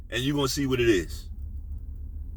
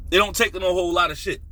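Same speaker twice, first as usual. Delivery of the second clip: normal talking voice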